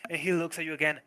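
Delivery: ordinary talk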